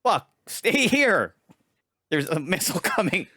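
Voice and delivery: high, loud, slow plea broken by laughs; retold panicked plea. Telling his wife to stay during the missile alert, high, slow and laughing. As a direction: replay the panic, laughing at it now. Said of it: "I'm like".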